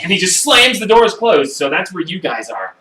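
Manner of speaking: ordinary delivery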